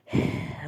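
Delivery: soft sad sigh. A narrator's reading. Amr sighs at talk of marrying, sadly. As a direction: let out a quiet, breathy sigh. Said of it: "اتنهد بحزن".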